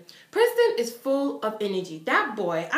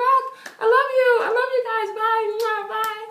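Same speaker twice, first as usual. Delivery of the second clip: higher, louder, rushed goodbye; excited farewell; 12 semitones higher, 6 dB louder than usual. Retold or imitated. Fan pulled away by security, calling goodbye excitedly. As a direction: call out love while being led off.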